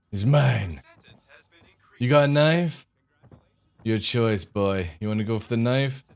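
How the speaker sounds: usual speaking voice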